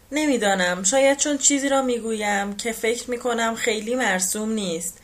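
usual speaking voice

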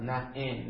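نعم with a first a, then e; kasra on second syllable. Shown as a demonstration. Teacher listing variants for students, na'em with e second. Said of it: "نعم شهد به کسرتین".

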